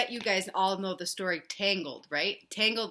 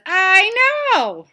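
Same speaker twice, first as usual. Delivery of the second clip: high, loud elephant voice; loud, high cartoon elephant; 11 semitones higher, 14 dB louder than usual. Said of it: "Elephant says".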